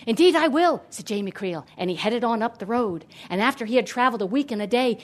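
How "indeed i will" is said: higher, louder, hearty promise; eager agreement. A narrator's reading. Hero eagerly agrees to help.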